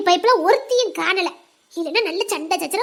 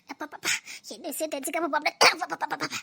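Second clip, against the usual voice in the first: quiet mutter; 6 semitones lower, 9 dB quieter, faster than usual. Acted. Weary old woman muttering.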